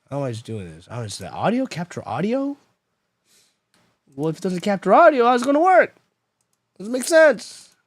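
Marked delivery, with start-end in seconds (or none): 4.1-7.7 loud, climbing high in disbelief; exasperated. Real, not acted. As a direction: protest the nonsense, pitch shooting up. Arguing with failing software.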